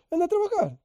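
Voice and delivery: high, sneering mock dismissal; the selfish rich man sneering at the beggar; mocking scold.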